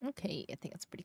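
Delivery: soft whispered aside. Real, not acted. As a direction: breathe it out under your breath. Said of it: "whispered aside".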